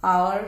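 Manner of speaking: "our" loud and clear, pronounced like hour. Shown as a demonstration. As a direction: punch "our" so it sounds like aawar. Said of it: "से उसी तरीके से pronounce करें".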